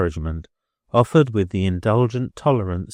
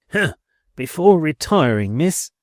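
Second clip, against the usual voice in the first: lofty landlady; 8 semitones higher, 5 dB louder than usual. A narrator's reading. Billiken addresses Rosa loftily.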